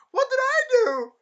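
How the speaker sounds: very high, loud squeal; hammed-up falsetto bewilderment; mock whining protest. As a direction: squeal it like a wronged innocent. Voicing the slapped man's protest.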